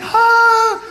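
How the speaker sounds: a very high, loud mock scream; terrified scream. Passenger certain of a crash, screaming. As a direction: shriek as the water rushes up. Plane about to hit water.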